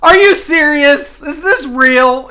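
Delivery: high, loud, slow disbelief; real outraged disbelief, loud and high; incredulous shout. Real, not acted. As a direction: shout it in disbelief, stretching each word. Disbelief at the game.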